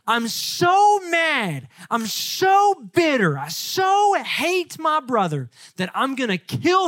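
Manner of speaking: slow, high, drawn-out mock rage; furious Esau. Esau vowing revenge on his brother, angry. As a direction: stretch each "so" with acted bitterness. Preacher voices Esau's fury at Jacob.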